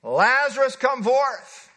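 booming order; Jesus ordering Lazarus out, bellowing; bellowed command. Acted. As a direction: bellow it as an order, not a suggestion. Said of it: "This was bellowed out".

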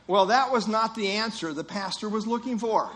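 ordinary talk

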